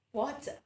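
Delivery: "Water" ending in "ah", r dropped; British pronunciation. Shown as a demonstration. Teacher repeats British WATER for the class, no final r. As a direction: say "Water" as "waw-tah". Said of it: "the word water is pronounced as".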